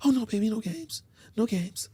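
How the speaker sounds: high, soft mock girlfriend; high placating woman's voice; imitating woman friend. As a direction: lift it high and softly reassure. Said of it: "I don't got no time for no games".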